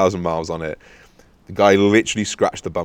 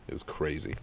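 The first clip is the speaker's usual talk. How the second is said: softer, lower musing; quiet wrap-up; 3 semitones lower, 12 dB quieter than usual. Real, not acted. Closing the story for listeners, quietly. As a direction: let it trail off, satisfied.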